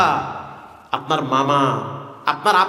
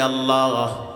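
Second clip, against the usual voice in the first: tearful prayer, retold; 4 semitones higher than usual. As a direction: imitate a weeping man's prayer in tuneful waz style. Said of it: "চোখের পানি ছেড়ে দিয়ে বলে". (সুর করে কান্নার দোয়ার অনুকরণ)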